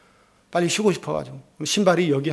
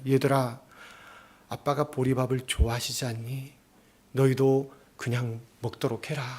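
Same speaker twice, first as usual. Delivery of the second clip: gentle mother; 4 semitones lower, 6 dB quieter than usual. Retold or imitated. Mother gently tells children.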